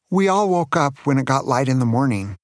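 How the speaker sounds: ordinary delivery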